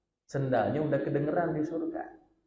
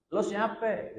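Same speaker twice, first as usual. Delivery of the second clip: mock arrogant boss; 9 semitones higher, slower than usual. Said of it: "oh gitu ngomongnya".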